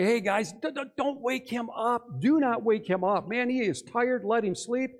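high, insistent acted plea to friends; urgent warning. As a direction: stammer into a protective hands-off warning. Imagined disciples urging the others.

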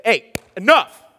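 loud, slow sharp order; commanding shout. Retold or imitated. Dad walking in and ordering the kids to stop, loud and slow. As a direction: stop the whole room with it.

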